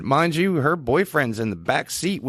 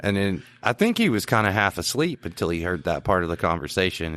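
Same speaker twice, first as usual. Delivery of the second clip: normal talking voice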